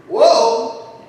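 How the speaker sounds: high, sudden exclamation; sharp high whoa; surprised whoa. Real, not acted. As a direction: yelp it out in surprise. Exclamation after political remark.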